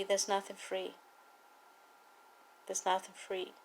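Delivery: usual speaking voice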